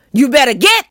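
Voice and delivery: high, loud threat; warning shout. A narrator's reading. Old woman warning hidden kids off her yard, angry shout. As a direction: shout to chase off pests. Miss Lottie warns kids off.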